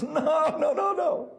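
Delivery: high-pitched words through acted laughter; imitating a Rebbe in hysterics; laughing hysterically. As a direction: giggle through the denial. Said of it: "starts giggling and laughing hysterically".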